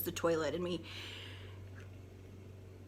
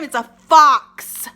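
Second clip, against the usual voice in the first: loud; 4 semitones higher, 17 dB louder, slower than usual. Real, not acted. Punchline after spooky buildup.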